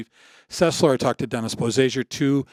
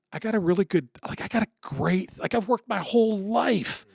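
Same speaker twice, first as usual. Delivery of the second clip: indignant protest; 6 semitones higher than usual. Retold or imitated. Arguing against cutting his career short, protesting. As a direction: plead the case against quitting, pitch swooping up. Acts out objection to retiring early.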